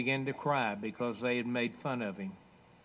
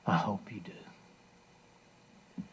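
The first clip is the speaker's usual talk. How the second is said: whispered appeal; 5 semitones lower, 4 dB quieter, much slower than usual. Real, not acted. Soft appeal after the story.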